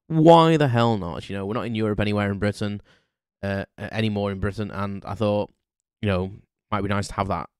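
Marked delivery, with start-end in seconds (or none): 0.1-1.3 loud, drawn-out, higher-pitched shrug-it-off; emphatic why-not. Retold or imitated. Podcaster recalling deciding on an Irish passport, emphatic why-not. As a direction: stretch it out with carefree defiance. Quotes own past thought, emphatic.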